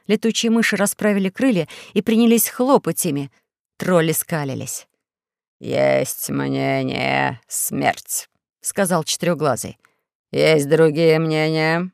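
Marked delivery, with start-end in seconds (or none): 5.6-11.9 Four-Eyes. Acted. Dictator asking for objections, low slow menace. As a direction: stretch every word like a lazy tyrant.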